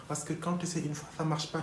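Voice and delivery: ordinary delivery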